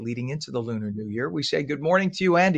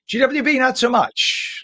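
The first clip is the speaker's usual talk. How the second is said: pitched way up, dragging out "not so much"; wry high exclamation; 11 semitones higher, 9 dB louder, slower than usual. Real, not acted. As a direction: ironic sing-song, much higher and louder. Traffic anchor mocking the jammed bridge.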